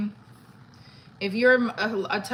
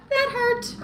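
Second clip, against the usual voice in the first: pained squeal; 4 dB louder than usual. Real, not acted. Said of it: "I just burned my hand".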